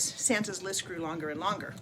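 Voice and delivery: ordinary delivery, nothing marked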